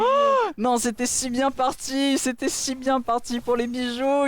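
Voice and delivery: shouted high oh no, dismayed; excited dismay. Real, not acted. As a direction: cry out in dismay. Caster reacts to lost fight.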